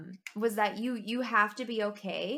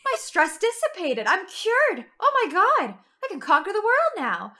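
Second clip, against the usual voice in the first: high, loud, gushing fake joy at being cured; sarcastic mock relief; 10 semitones higher, 7 dB louder than usual. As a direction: overplay the relief to mock the advice. Sarcastic reply to manager's advice.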